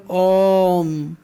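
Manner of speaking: ओम held long on the vowel, like a chant; prolonged om. Shown as a demonstration. Teacher to class, demonstrating the extra-long vowel, drawn-out om. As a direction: stretch ओम out to show the pluta vowel. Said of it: "तो इसमें ज़्यादा समय लगा".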